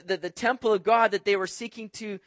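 ordinary talk

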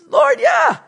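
high, loud, exasperated yes; bothered insistence. Acted. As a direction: insist loudly, pitch shooting up. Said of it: "trying to get a little bothered by this time".

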